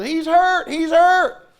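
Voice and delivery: high, loud frantic cry; party dad yelling in mock alarm; panicked yell. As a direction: shout it again and again in panic. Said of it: "He comes running across".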